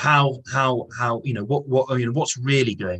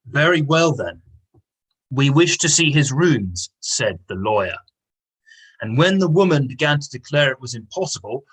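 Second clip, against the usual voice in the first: reading aloud with raised, louder quoted lines; story reading; 4 dB louder than usual. Acted. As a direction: act out the lawyer and the housekeeper lightly while reading. Teacher reads passage, voicing characters.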